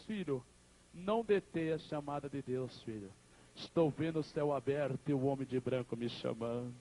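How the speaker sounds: low, subdued farewell to a son; acted dying mother. Dying mother soothes her son.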